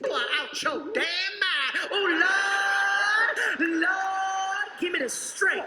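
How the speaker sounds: high, wailing plea to the Lord; exasperated cry for heavenly patience; indignant prayer. Acted. Same prayer, clip replayed.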